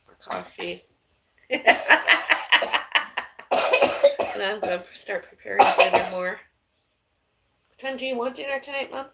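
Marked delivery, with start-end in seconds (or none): 1.5-4.3 high burst of laughter; big high laugh; loud laugh. Real, not acted. Laughs after more coffee. 7.7-9.1 calling out. Real, not acted. Calling to mom in another room.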